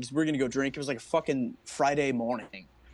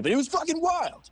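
amazed excitement; 13 semitones higher than usual. Real, not acted. Storyteller summing up the fight for his friends, excited. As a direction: pitch soaring with disbelief.

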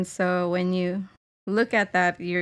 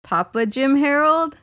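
higher, louder questioning punchline; hammed-up pun delivery; 5 semitones higher, 7 dB louder than usual. Reading own pun text to co-host, playful. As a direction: offer the pun like a big reveal. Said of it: "And I said".

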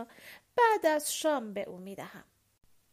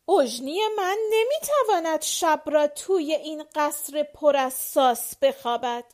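loud, higher, whining complaint; louder plaintive grievance; complaining; 5 semitones higher, 10 dB louder than usual. A narrator's reading. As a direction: protest to the marshal about the castle. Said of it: "با صدای شکوه آمیزی گفت".

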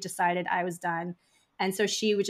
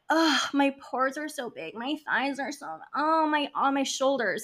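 higher-pitched mock self-critical whining; complaining mean girls; 5 semitones higher than usual. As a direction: play girls fretting at the mirror. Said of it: "all the girls are in front of the mirror".

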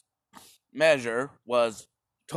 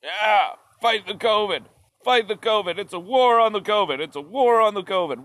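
higher, eager mock chant; gung-ho crowd; 5 semitones higher than usual. People cheering the war on covid, higher mock rally chant. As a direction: pump it up like a rally slogan. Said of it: "people were like".

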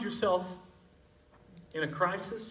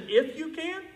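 high, incredulous question; Jesus surprised at the father's words; 9 semitones higher than usual. Retold or imitated. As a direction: echo it back in disbelief. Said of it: "Jesus seems surprised".

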